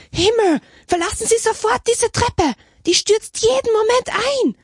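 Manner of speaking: very high, urgent warning cry; alarmed shout. A narrator's reading. Architect ordering the sister off the staircase, alarmed shout. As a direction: cry out in high-pitched alarm to get them off. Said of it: "rief der Architekt Muli".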